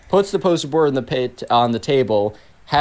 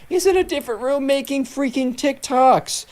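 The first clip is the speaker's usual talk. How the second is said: much higher incredulous outburst; outraged; 12 semitones higher than usual. Real, not acted. [name], outraged at Kenna, spikes much higher in disbelief. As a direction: sound outraged at the nerve of it.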